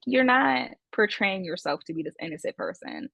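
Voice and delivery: ordinary talk, nothing marked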